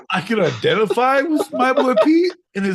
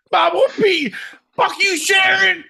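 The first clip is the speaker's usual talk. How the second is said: high, loud mock yell; parting yell; 12 semitones higher, 4 dB louder than usual. Yelling at Sharon while being hauled off, mock rage. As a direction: hurl it back over the shoulder.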